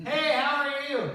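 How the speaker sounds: hammed-up cheery hospital greeting; fake cheerful greeting. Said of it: "all of a sudden I go".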